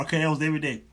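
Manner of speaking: normal talking voice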